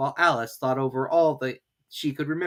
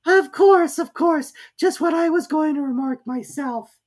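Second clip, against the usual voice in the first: very high, loud sleepy squeak; impatient Dormouse; 8 dB louder, slower than usual. A narrator's reading. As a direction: snap without waking. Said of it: "shook its head impatiently".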